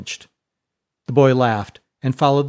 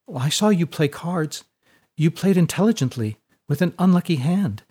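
higher-pitched, proper character voice; earnest, well-bred Fiona; 6 semitones higher than usual. Acted. Fiona counters Seinen's talk of luck, earnest and higher. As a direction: lift the pitch, politely insistent.

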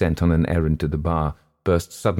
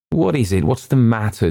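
urgent, raised-pitch questioning; demanding; 6 semitones higher, 4 dB louder than usual. A narrator's reading. Malcolm questioning the shaking newcomer, demanding. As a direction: demand an answer at once. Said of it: "demanded Malcolm".

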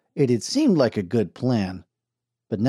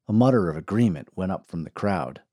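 ordinary delivery, nothing marked

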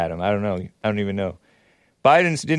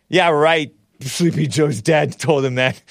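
sarcastic; 3 semitones higher, 3 dB louder, slower than usual. Real, not acted. Sarcastic reply to Biden story.

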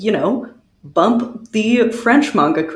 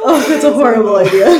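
fast, laughing and louder; cracking up, louder; 5 dB louder, faster than usual. Real, not acted. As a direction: laugh it out in mock horror. Laughing at own joke.

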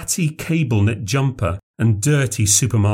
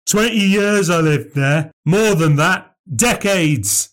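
put-on higher, gruff old drunk insisting; furious old man; 7 semitones higher, slower than usual. A narrator's reading. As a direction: jab every word at him. Old man prodding Christopher, furious.

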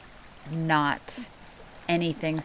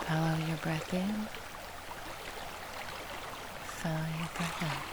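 near whisper; 13 dB quieter than usual. Acted. Meditation fading to near silence.

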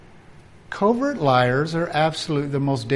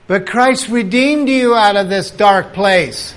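much louder and higher declaration; loud proclamation; 5 semitones higher, 8 dB louder than usual. Acted. Preacher countering the fearful believer with a loud proclamation. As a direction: proclaim it over them. Preacher answering tomb-dweller.

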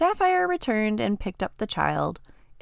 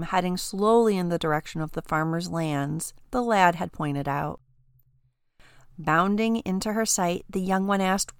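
usual speaking voice

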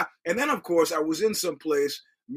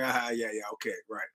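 dismissive mumble; 6 semitones lower, 8 dB quieter than usual. Retold or imitated. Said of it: "I was like".

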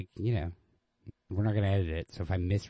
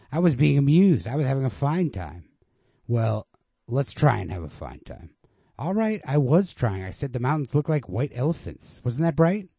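mock-bright sarcasm; 6 semitones higher, 8 dB louder than usual. Girl's bitter sarcastic quarrel lines.

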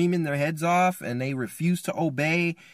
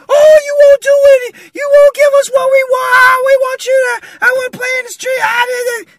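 screeching, very high hammed-up wail; hysterical mock tantrum; 14 dB louder than usual. Family in sin wails at father, hysterical mock screaming. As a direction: scream like kids in emotional chaos. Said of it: "Chaos and terror and, and, and emotions".

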